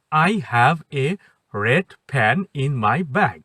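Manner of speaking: very slow, drawn out "I have a red pen in my bag"; base sentence. Shown as a demonstration. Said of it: "এটা ছিল মূল কথা".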